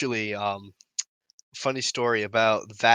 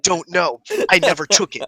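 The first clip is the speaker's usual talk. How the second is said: protesting innocence; 5 semitones higher, 7 dB louder than usual. Retold or imitated.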